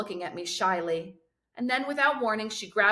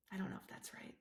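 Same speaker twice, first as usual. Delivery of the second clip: unvoiced whispered aside; 18 dB quieter, much faster than usual. A narrator's reading. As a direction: breathe it as a private aside. Aside after Pitbull line.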